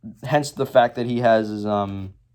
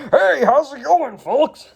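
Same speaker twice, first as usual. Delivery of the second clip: loud, high jock greeting; booming high-pitched jock impression; 12 semitones higher, 5 dB louder, slower than usual. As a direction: holler it like an upbeat jock. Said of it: "kinda sounds like a jock".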